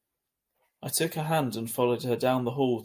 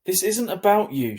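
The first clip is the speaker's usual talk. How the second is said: loud, higher snap back; yelled; 7 semitones higher, 7 dB louder than usual. A narrator's reading. Sister yelling at Augie over the dog, loud. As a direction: yell it at him. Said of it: "she yelled".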